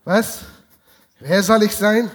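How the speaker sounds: ordinary delivery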